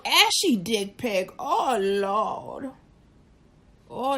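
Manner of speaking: ordinary delivery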